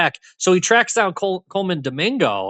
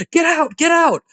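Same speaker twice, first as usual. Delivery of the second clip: high-pitched mock yell, only a little louder; retold yell; 13 semitones higher, 3 dB louder than usual. Character warning coworkers who walk in, high mock yell. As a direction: imitate an urgent warning, pitched right up. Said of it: "yells at them".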